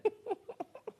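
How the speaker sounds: high, light giggle; a short amused giggle; kind gentle laugh. Real, not acted. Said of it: "Stop laughing at me".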